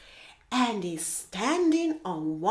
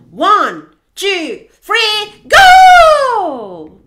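very loud count, climbing to a long "go"; race-start shout; 9 semitones higher, 21 dB louder, much slower than usual. Acted. Hippo starting a race, huge excited countdown shout. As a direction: yell them off the starting line.

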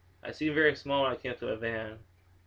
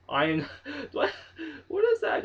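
ordinary talk

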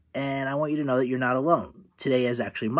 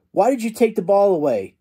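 higher, louder indignant demand; angry question; 6 semitones higher, 4 dB louder than usual. A narrator's reading. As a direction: Charlie Brown confronting Lucy after falling, angry. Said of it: "asks Lucy angrily".

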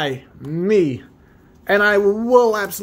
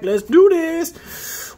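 hype opener; 11 semitones higher, much slower than usual. Real, not acted. Hyping himself up to open packs.

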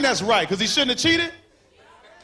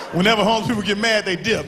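usual speaking voice

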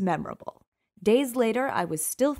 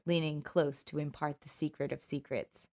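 plain reading voice

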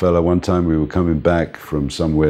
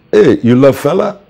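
playful challenge; 9 semitones higher, 7 dB louder than usual. Retold or imitated. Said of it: "And I say".

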